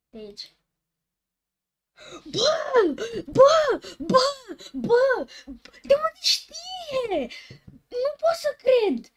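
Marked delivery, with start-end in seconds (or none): 2.2-5.3 real high, loud startled shouts; shocked gamer shouts at the screen; startled yelps. Real, not acted. Jumpscare reaction in horror game. 5.8-7.3 high, loud, laughing disbelief; freaked-out laughing yell; shocked disbelief. Real, not acted. Game knows his country. 7.9-9.1 high, loud disbelief; real startled outcry; shocked shout. Real, not acted. Shocked by game.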